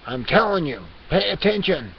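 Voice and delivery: loud, insistent telling-off, rising in pitch; hammed-up cat scolding; exasperated scolding. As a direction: demand their attention. Cat persona scolds viewers.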